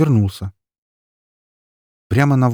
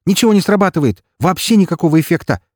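высоко и громко, с напором; выкрикни в бессилии; отчаянное восклицание; 11 semitones higher, 8 dB louder than usual. Acted. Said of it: "воскликнул реаниматолог".

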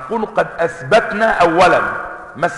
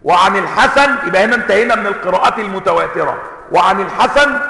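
ordinary delivery

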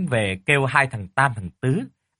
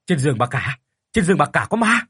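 frightened; 2 semitones higher, 3 dB louder than usual. A narrator's reading. Terrified master reports ghost.

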